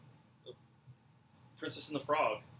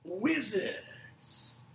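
high, drawn-out magical "wizards"; teleportation sound; 5 semitones higher than usual. Acted. Wizards teleporting away, high drawn-out magic sound. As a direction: stretch it into a trailing effect. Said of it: "That's the teleportation sound".

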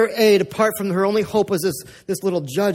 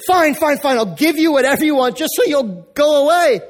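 high, loud, fed-up surrender; exasperated judge giving in; 6 semitones higher, 4 dB louder than usual. Retold or imitated. Preacher voicing the worn-down judge relenting to the widow, loud exasperation. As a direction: throw up your hands and give in. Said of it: "He's like".

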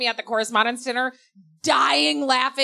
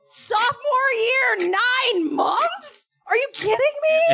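much higher, stretched disbelief; mock outrage; 13 semitones higher, much slower than usual. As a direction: squeal at the unfair punishment. Retold outrage at sentence.

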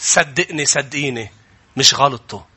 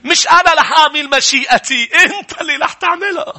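preaching at full force; 4 semitones higher than usual. Acted. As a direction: build it up like a fiery preacher.

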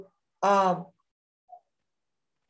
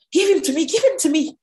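high, louder insistent plea; urgent demand; 7 semitones higher, 5 dB louder, faster than usual. Acted. Elijah to the widow about her son, urgently insisting. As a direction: press the demand, pitch lifted.

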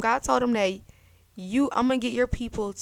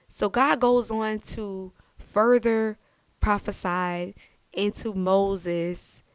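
normal talking voice

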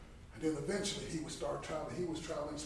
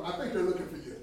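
laughing; 2 semitones higher, 6 dB louder, faster than usual. Retold or imitated. Joking quote, laugh heard.

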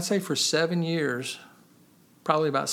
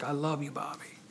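tender, hushed; 9 dB quieter than usual. Retold or imitated. Voicing God speaking tenderly to him.

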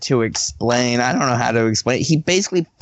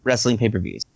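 usual speaking voice

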